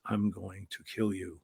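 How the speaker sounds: low, slow, quiet menace; a wrestler quietly threatening a scared mark, low and slow; menacing threat. Retold or imitated. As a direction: growl it low and slow like a one-eyed killer. One-eyed wrestler threatens the mark.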